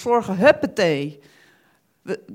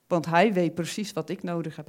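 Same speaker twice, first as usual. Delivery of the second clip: normal talking voice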